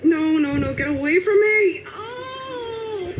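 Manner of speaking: high, loud no ending in a crying gasp; panicked cry. Real, not acted. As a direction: scream at the attacker to back off. Attacked in game.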